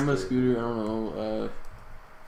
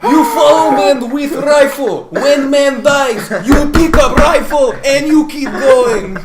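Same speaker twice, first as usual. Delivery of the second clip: loud, high broken-English Russian orders; a loud, hammed-up Russian commander sending men forward; mock Russian officer; 10 semitones higher, 10 dB louder than usual. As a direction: bark it as a Soviet commander.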